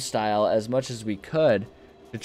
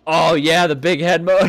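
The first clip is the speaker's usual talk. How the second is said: loud, higher, delighted; excited; 5 semitones higher, 9 dB louder than usual. Real, not acted. Gamer spotting big head mode, thrilled and loud. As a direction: burst out with glee at the discovery.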